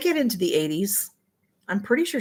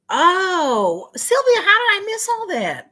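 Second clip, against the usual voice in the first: surprised dismay; 9 semitones higher, 5 dB louder, slower than usual. Real, not acted. Learns Sylvia won court case.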